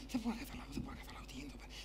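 very soft, whispered muttering; self-doubting whisper. Acted. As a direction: whisper to yourself, lost and baffled. George doubts himself alone.

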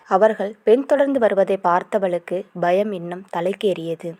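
ordinary delivery

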